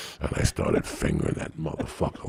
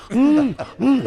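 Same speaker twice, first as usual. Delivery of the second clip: imitating a muffled mm mm, higher; mock gagging mm sounds, pitched up; muffled moan; 3 dB louder than usual. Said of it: "She's like".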